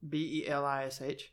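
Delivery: letter by letter, B E L I S H; spelling it out. Real, not acted. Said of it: "How do you spell that?".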